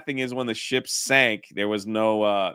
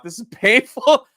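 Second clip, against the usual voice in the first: laughing; 13 semitones higher, 8 dB louder than usual. Real, not acted.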